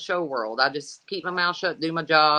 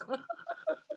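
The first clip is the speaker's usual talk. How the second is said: trailing laugh; 13 dB quieter than usual. Real, not acted. Laughs at own story.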